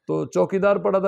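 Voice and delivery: usual speaking voice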